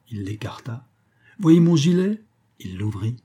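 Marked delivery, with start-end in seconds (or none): 1.4-2.2 lightly acted magician, high and loud; showman's patter. A narrator's reading. Magician has the ladies inspect his clothes, showman's patter. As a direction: show it off with a rising lift.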